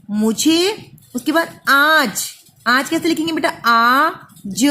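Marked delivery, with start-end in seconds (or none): none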